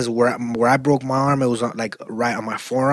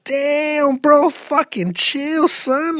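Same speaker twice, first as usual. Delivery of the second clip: mock parent plea; 13 semitones higher, 4 dB louder, much slower than usual. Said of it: "As a parent, you're like".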